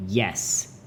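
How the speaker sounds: "Yes" short with a falling tune; angry tone. Shown as a demonstration. Teacher demonstrating fourth tone, "Yes" falling, mildly angry. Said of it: "angry tone".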